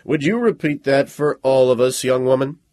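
single out the chatty student; stern officer calling out. A narrator's reading. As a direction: lecturer calling out a talking student. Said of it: "Major Casals said".

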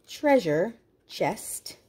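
ordinary delivery, nothing marked

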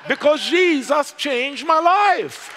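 high, loud, slow, laughing praise; joyful praise. Real, not acted. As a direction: rejoice out loud.

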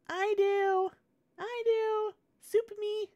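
put-on very high squeaky child voices; squeaky kids begging for soup; eager kid voices. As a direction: pipe up like excited little kids. Villagers clamouring for soup.

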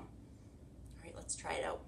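soft unvoiced whisper. Real, not acted. Teacher whispering calm instructions to the class. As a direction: keep it barely a breath.

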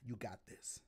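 breathy whisper to himself; low whispered self-encouragement; whispered pep talk. Real, not acted. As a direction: psych yourself up under your breath. Hyping himself up before a fight.

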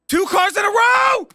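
high cry of outrage; exasperated shout. Real, not acted. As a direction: yell in disbelief at bad luck. Run over twice.

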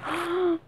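quick, soft gasp of surprise; delighted gasp. Real, not acted. Delighted surprise on unwrapping gift.

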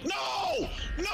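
sudden anguished yell; shout. Real, not acted. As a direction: cry out in dismay. Teammate about to lose cash-out.

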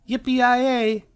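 very high, loud cowboy yell; excited cry. A narrator's reading. Cub on a trike answers her friend, whooping. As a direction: let out a gleeful rodeo whoop. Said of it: "cried Sister".

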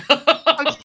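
high burst of laughter; real laughing fit; loud laugh. Real, not acted. Laughing at bark joke.

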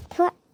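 a loud, sharp spitting "thu"; disgusted spit. Acted. Angry sister spits at the brother, loud scornful spit. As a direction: spit the sound out in contempt.

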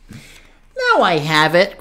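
slow, drawn-out little bird's exclamation; crying out. A narrator's reading. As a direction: announce the idea, stretching it out. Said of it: "cried the little bird".

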